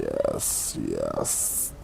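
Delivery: low, whispered reptile hiss; lizard hiss. Retold or imitated. Reptilian sheep hearing the streamer, whispered low hiss. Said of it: "secretly are hearing me going".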